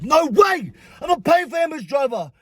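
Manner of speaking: high, indignant retold shout; acted-out outraged protest; raging refusal. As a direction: refuse outright, re-enacting the row. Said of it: "I'm a big raging leber".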